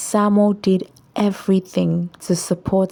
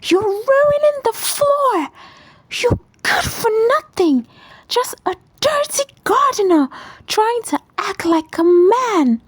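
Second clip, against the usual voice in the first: voiced much higher, contemptuous wife; high shrill scold. A narrator's reading. Wife berates her dripping husband, high contemptuous scolding.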